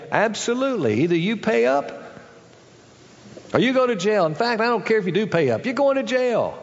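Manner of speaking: high, pressing, climbing threat; merciless master. Acted. King rejecting the debtor's plea with a rising harsh tone. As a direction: pile on the pressure until he breaks. Master refuses mercy, escalating.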